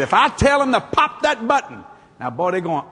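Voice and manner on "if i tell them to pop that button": louder and higher, building up; excited. Retold or imitated. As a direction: sell the big moment. Excited retelling.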